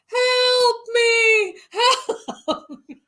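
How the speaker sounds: high, loud, half-laughing cry for help; mock scream for help. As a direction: ham up a trapped man yelling help. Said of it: "you're screaming".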